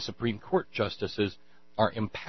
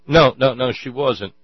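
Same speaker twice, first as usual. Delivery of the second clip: loud protest; 4 semitones higher, 10 dB louder than usual. Real, not acted. Objecting to the clip.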